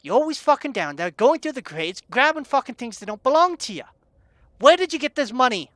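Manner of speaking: irate barkeep interrogating; angry accusation. Acted.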